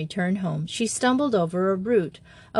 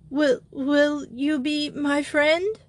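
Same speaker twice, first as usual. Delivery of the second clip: slow, higher, tearful croak; croaked through tears; 7 semitones higher, slower than usual. A narrator's reading. Simone begging Jo for friendship, tearful croak. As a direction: force the words out through crying. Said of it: "she croaked out".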